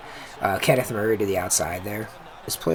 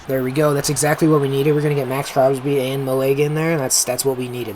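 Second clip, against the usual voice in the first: loud, raised, excited play reaction; pumped-up approval; 4 semitones higher, 6 dB louder than usual. Real, not acted. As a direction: celebrate the big play out loud. Celebrating a needed big play.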